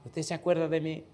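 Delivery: soft, lightly put-on student voice; her way of talking. Teacher imitating the tearful student greeting him. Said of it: "como ella habla".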